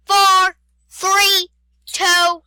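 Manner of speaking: high, loud count; loud countdown. Pretend. Player counting down for a trapped friend, loud countdown. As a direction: call out each number forcefully.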